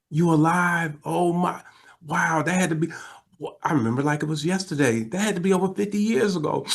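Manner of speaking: high-pitched imitation of astonishment; amazed recollection. Husband learning the baby is alive, imitated amazement. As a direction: marvel as an old man remembering. Old man retold amazed at news.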